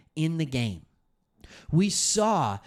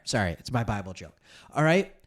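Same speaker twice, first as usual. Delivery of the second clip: ordinary talk, nothing marked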